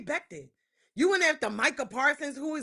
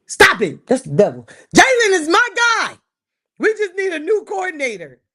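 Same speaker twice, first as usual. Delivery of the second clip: louder, rebuking the temptation; loud self-scold; 4 semitones higher, 10 dB louder than usual. Real, not acted. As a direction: snap out of the fantasy, loud. Scolding herself loudly.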